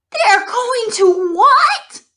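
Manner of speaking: high terrified pig scream; panicked piglet screaming. A narrator's reading. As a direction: shriek it in panic, pitch way up and slow. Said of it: "screamed Wilbur".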